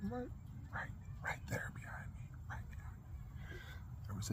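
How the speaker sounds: suspense whisper. Acted. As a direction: whisper it like a scary secret. Building suspense about the spider.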